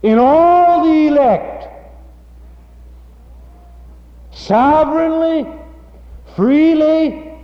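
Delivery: high, loud pulpit proclamation; preaching at full force. Acted. Preacher to congregation, proclaiming the Reformed answer: full-force preaching. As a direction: proclaim it with rising fervour.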